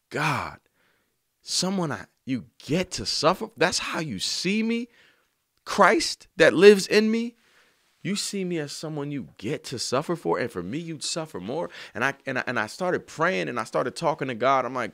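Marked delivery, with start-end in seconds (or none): 0.1-11.7 tearful prayer. Retold or imitated. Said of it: "I just sat there in tears".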